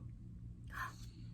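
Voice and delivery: soft shocked gasp; quiet pretend gasp. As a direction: gasp in mock surprise for the kids. Reacting to dragon's graffiti.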